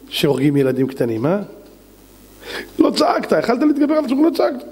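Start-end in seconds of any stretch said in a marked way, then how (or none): 2.8-4.6 high, rapid-fire reproach; indignant high-pitched rebuke; sharp accusation. Acted. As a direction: accuse him in a raised pitch. Acted accusation of silent Job.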